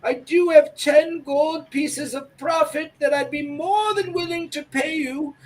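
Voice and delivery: put-on old lady voice. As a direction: plead gently like a poor old shopkeeper. Shopkeeper Edith talks.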